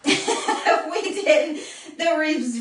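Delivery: slow words through a laugh. Real, not acted. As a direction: laugh while trying to talk. Laughs, still out of breath.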